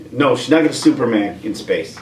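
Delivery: ordinary talk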